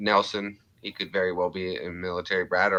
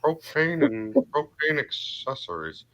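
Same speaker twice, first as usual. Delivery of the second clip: Hank Hill; 6 semitones higher than usual. Acted. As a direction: impersonate an earnest cartoon dad, pitched up. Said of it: "doing a little bit of a Hank".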